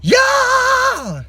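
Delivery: high screamed yeah; raspy fry scream. Shown as a demonstration. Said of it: "with the fry scream".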